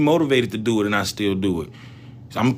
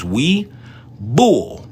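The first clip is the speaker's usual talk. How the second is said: stretched-out bull; 3 semitones higher than usual. Real, not acted. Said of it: "Sorry for screaming bull like that".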